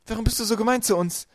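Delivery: high, loud hurt reproach; crying out. A narrator's reading. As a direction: plead loudly with your tormentor. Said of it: "rief Violet". (laut, hoch und verletzt gerufen)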